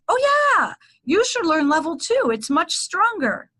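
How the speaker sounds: high, lilting mock enthusiasm; breezy enthusiasm. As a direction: imitate an eager teacher drawing out the good news. Imitates teacher's breezy upsell.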